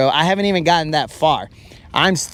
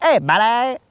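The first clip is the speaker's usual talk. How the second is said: mock cocky flirt; 7 semitones higher than usual. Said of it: "they'd be like".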